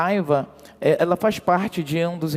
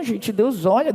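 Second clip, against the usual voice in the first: ordinary talk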